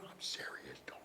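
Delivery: hushed, firm whisper; serious whisper. Retold or imitated. Host pulling the mic away to whisper a warning. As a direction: drop it to a whisper, dead serious.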